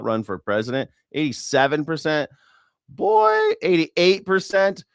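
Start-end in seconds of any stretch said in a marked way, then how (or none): 2.9-4.8 squeaky high yelp of surprise, then a slow louder read of the number; surprised. Real, not acted. Streamer reacting to the poll numbers, surprised and amused. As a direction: jump up in pitch, amazed.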